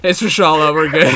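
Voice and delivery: high, loud frantic callouts; excited shouting. Real, not acted. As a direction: shout the discovery over and over. Boss spotted, frantic callouts to teammate.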